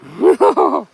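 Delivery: a quick high burst of laughter; short laugh. Real, not acted. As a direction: laugh at the silly find. Laughing at lost glasses.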